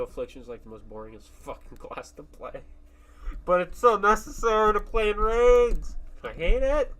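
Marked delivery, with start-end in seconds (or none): 3.4-6.9 loud complaint. Real, not acted.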